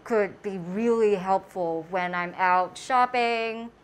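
muffled behind mask; speaking with covered mouth; through a face mask. Real, not acted.

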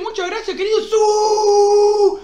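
high, excited shout-out ending in a long cheer; excited thanks. Real, not acted. Streamer thanks Feli and asks for luck, excited high voice. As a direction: thank them high-pitched, finishing on a stretched whoop.

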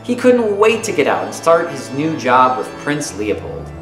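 normal talking voice

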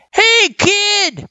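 high-pitched call across a distance; shouted thanks. A narrator's reading. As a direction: shout it as if through cupped hands. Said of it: "she shouted".

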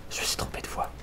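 whispering. Real, not acted. Reader's aside after wrong voice.

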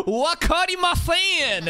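high, drawn-out foreigner's Japanese; mock a gaijin speaking Japanese, slowly and high-pitched; gaijin-accented Japanese. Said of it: "She's talking like a gaijin".

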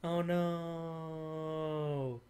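soft, stretched-out "oh no"; long drawn-out dismay. Real, not acted. Player watching things go wrong, drawn-out soft dismay. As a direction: let the last vowel hang and hang, softly.

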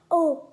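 sharp gasp from the spice heat; pained gasp. Real, not acted. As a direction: yelp at the burning noodles. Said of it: "it's, like, burning hot".

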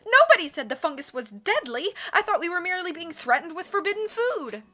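high, loud outraged panic; panicked shrieking. A narrator's reading. Islander learns the fungus is deadly, shrieking in panic. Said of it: "Madame Nordhoff shrieked".

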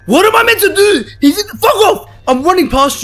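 very high, loud frantic scream; shrieking in alarm; panicked yell. Real, not acted. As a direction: scream at the monster in your way. Enemy blocks path, screams.

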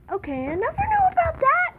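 high astonished exclaim; surprised. Real, not acted. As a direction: gasp it out in surprise. Surprised by teleporter.

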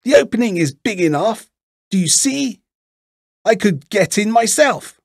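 put-on very high old-witch voice; exasperated old witch. A narrator's reading. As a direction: explain impatiently in a high witch voice. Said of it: "said the old woman".

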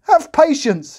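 very high, loud desperate plea; pleading cry. A narrator's reading. The kneeling servant crying out to the king. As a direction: beg for mercy on your knees. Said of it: "he cried".

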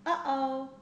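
worried little gasp of alarm. A narrator's reading. Child reading a story, alarmed at a wobbly tooth. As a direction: sound alarmed, the tooth is loose.

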